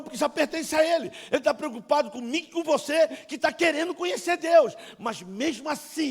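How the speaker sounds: high, loud, driving sermon; impassioned preaching. Real, not acted. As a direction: press the warning on the congregation at full force. Preaching at full force.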